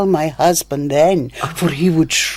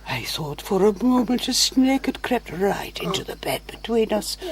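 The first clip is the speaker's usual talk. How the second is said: whisper; 9 dB quieter than usual. Acted. Seductive hushed confession in bed.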